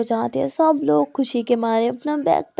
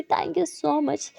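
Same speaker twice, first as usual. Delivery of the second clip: soft thanks; 4 semitones higher, 4 dB quieter, much slower than usual. Acted. Teacher thanks student softly.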